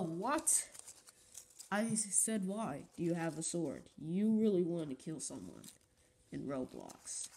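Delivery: normal talking voice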